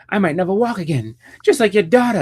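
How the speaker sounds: high-pitched, gleeful mock taunt; taunting villain. Voicing Joker taunting Gordon, then laughing.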